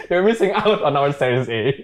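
laughing taunt. Pretend. Founder jokes back at the doubting series A investor, laughing. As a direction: toss out a playful boast through laughter. Joking retort to investor who belittled him.

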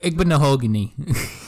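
Igbinoghene said slowly and surely as "igban-oh-HOG-nee"; sure-footed, drawn-out "igban-oh-hogny" for Igbinoghene; confident mispronunciation. Shown as a demonstration. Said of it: "I said it with confidence".

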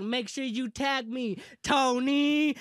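slow, building to a loud high shout on the name; taunting call-out. Retold or imitated. Retelling a challenge to the seller, shouted taunt. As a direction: draw it out, then yell the name.

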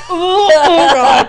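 loud, high, drawn-out groan of disgust; slow, squealing ew; grossed-out groan. Retold or imitated. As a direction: recoil from the clammy hug out loud. Reacting to clammy hug.

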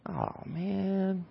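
quietly moved, almost to himself; touched. Retold or imitated. Chaperone as a little girl holds his hand, softly touched.

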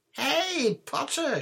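very high, piercing mock call; high-pitched jeering screech; taunting shriek. A narrator's reading. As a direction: shriek it across the hall to taunt. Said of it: "shrieked Pansy Parkinson".

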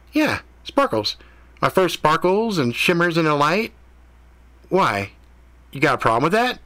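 defensive cat. A narrator's reading. Cat defending its name to Ethan, high and defensive. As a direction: dare him to object.

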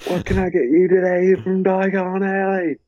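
high, hammed-up British shopkeeper; mock British lilt, high all through; Harry Potter accent. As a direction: put on a British wizarding accent, pitched well up. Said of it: "like, the Harry Potter accent".

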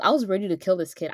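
ordinary delivery

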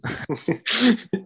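high burst of laughter; laughing burst. Real, not acted. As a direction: laugh out suddenly. Laughs at VR joke.

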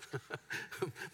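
rueful laugh. Real, not acted. Rueful laugh after his plan stopped.

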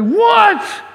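high, outraged what; indignant what. Retold or imitated. Cain bristling at God's refusal, high outraged what. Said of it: "He's like".

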